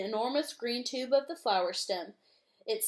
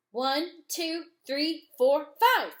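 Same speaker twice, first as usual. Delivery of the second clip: counting, louder toward the end; calling out; 6 semitones higher, 6 dB louder, slower than usual. Acted. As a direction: call out the count, louder on the last numbers. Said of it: "he called out".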